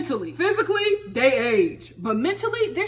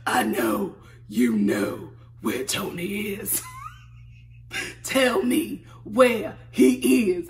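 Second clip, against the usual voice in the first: mock Angela; 3 semitones lower, slower than usual. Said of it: "Angela's just like".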